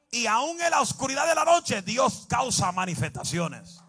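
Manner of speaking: preacher proclaiming to the church, loud and fervent; full-force preaching. Acted. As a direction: thunder it out over the congregation.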